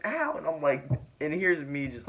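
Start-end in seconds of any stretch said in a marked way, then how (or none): 0.1-0.4 very high mock "ow"; high-pitched retold yelp; imitated pained yelp. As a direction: squeal it like someone poked in the face. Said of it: "She goes".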